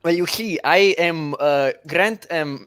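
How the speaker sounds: put-on halting Slavic accent, rolled R's; Slavoj Žižek. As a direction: explain yourself in a heavy Slavic accent. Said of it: "your Slavoj Zizek accent".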